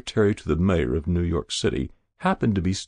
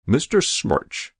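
slow, higher, reproving address; stern; 6 semitones higher, slower than usual. A narrator's reading. Official rebuking the seated hero, sternly. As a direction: call him to order sternly. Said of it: "said someone sternly".

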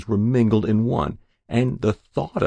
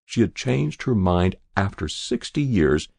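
plain reading voice

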